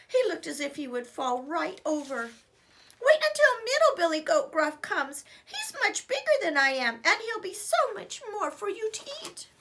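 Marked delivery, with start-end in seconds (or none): 3.0-9.5 very high pleading goat; high little goat voice. Acted. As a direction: squeak it out quickly. Little goat bargaining, scared.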